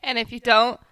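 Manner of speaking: sassy. Real, not acted. Sassy outro line.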